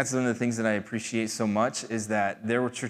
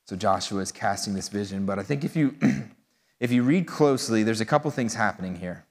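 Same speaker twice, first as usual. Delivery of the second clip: ordinary talk, nothing marked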